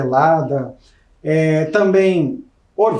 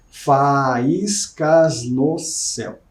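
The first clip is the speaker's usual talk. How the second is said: "Faíscas no céu" said slowly, fa-ís-cas no céu spaced out; syllable counting; 3 semitones lower, much slower than usual. Shown as a demonstration. Teacher testing a word swap for the class, slow syllable by syllable. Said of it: "Ó, vamos ver".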